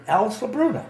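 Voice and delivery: name called out high; teacher's roll call. Retold or imitated. Teacher repeating a name nobody answers, calling out. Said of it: "he's calling a roll call".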